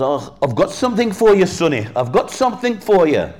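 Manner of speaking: high, loud put-on British man's voice; British accent. A British guy greets the boy on the suitcase, putting on a British accent. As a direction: call out as a British stranger to a boy. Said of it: "it was a British guy".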